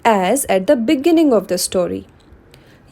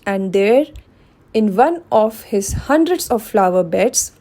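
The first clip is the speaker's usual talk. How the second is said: plain reading voice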